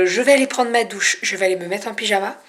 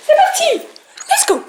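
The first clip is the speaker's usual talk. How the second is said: high, excited squeal; excited cheer; 3 dB louder than usual. Real, not acted. Cheering setting off in car.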